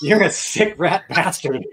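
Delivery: hammed-up insult between friends; mock disgusted Marty. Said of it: "and said".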